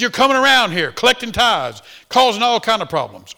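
loud, high accusing rant; preacher acting out the furious pastor blaming Hagin, loud and high; angry pastor. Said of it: "Oh, he was mad".

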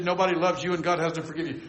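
ordinary talk

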